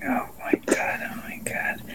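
breathless horrified whisper. Real, not acted. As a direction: gasp the words under your breath. Horrified reaction at table.

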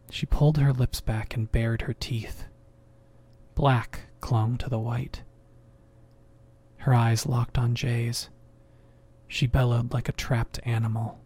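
ordinary delivery, nothing marked

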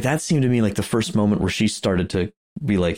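normal talking voice

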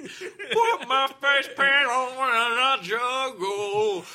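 high, loud, slow clown voice; silly clown. Acted. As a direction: ham it up as a goofy clown. Mocking Juggalo clowns.

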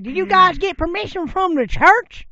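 high, loud Southern demand; deep Southern accent. Retold or imitated. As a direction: mimic an indignant Southern local hollering. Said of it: "deep southern accent".

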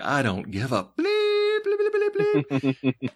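slow lead-in, then high squealed bleeps; mock swearing drowned in voiced bleeps; imitated censor bleeps. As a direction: mimic a censored rant with high bleep noises. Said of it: "looks at him and is like".

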